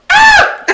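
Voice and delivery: very high, loud mock scream; imitated pain scream. Poops reacting to a kick in the nuts, mock scream. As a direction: shriek like someone just kicked in the groin. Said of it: "Poops' immediate response was".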